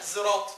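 زراط opening on a buzzed z in place of s; زراط with z sound. Shown as a demonstration. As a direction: voice the first consonant of زراط as z. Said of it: "زراط دي قراءة حمزة".

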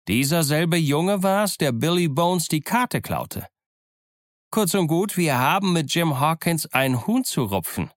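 high, agitated accusing sailor; heated, high-pitched denunciation; angry accusation. Acted. Old sailor angrily accuses the boy.

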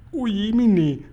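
put-on high, small girlish exclamation; little girl. A narrator's reading. Sophie reacts to the giant's story, high and small. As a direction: gasp it out like a startled little girl. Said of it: "sagte Sophiechen".

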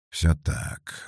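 soft, breathy sigh of assent. A narrator's reading. Felix answering Darya's question about what's wrong, sighing. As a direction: sigh the words out. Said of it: "вздохнул Феликс".

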